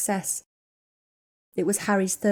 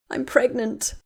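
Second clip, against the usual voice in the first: tearful confession; 5 semitones higher, slower than usual. Acted. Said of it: "Ginny burst into tears again".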